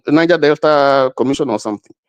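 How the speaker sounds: normal talking voice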